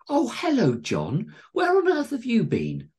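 high, loud fussing welcome; light up at your favourite guest; delighted greeting. A narrator's reading. Missus Tingleberry greeting favourite guest John, delighted. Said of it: "She lit up. It was her favourite guest".

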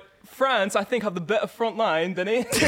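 wobbly British accent that slips towards German. Shown as a demonstration. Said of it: "Sounds like German".

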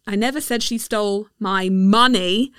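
"money" stressed at the end. Shown as a demonstration. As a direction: land on "money". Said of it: "depending on the stressed word".

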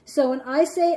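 usual speaking voice

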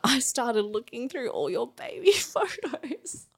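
slow down and crack into crying; breaking into tears. Real, not acted. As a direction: voice climbing high as real tears come. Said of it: "I'm crying".

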